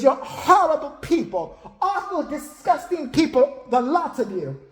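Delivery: high, slow, hammed-up disgust; imitated condemnation. Kim telling off the whole house, high slow mock outrage. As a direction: denounce them all, drawing out the insults.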